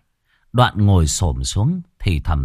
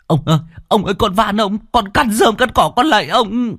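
desperate pleading; 12 semitones higher than usual. Acted. Peasant begging while beaten, frantic high pleading. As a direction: beg for your life under the blows.

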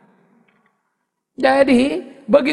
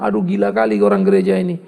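much lower, a grumbling devil; devil's voice. Retold or imitated. The devil complaining about the church people, in a low grumbling voice. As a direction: groan it out like a fed-up devil. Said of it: "Setan pun lalu bilang".

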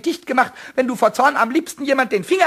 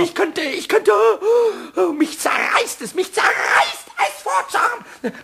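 shrieking with fury, climbing higher and higher; boiling rage; 5 semitones higher than usual. Acted. Pumuckl raging at Meister Eder, furious shout. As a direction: let the anger tear out of you in a rising shout. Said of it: "richtig kochend vor Wut".

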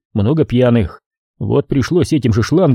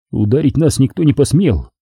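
ordinary delivery, nothing marked

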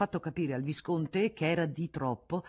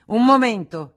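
loud, higher outburst; exclamation; 7 semitones higher, 15 dB louder than usual. A narrator's reading. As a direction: cut him off with a loud cry. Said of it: "esclamò Federico".

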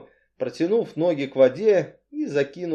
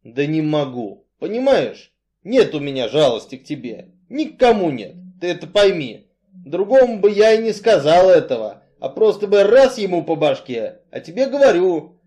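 louder, insistent explanation; blunt Artyom, exasperated; 4 semitones higher, 7 dB louder than usual. A narrator's reading. Strongman insists Cain must understand, exasperated. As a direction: press the point home loudly.

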